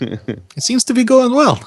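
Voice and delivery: high, drawn-out in-character remark; high-pitched quip. Acted. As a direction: sing out the quip high and slowly. Player voices character's quip before walking off.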